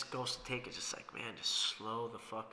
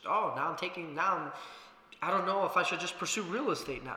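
mock doubter; 6 semitones higher, 8 dB louder than usual. Mocking an imagined doubter's reaction.

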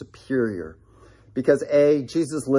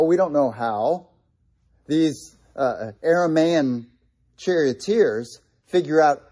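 ordinary talk

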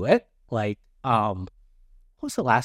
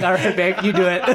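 higher, laughing handoff to babe; mock-lazy, laughing as he gives up; 6 semitones higher, faster than usual. Man hands task to girlfriend, laughing. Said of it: "I'll be like".

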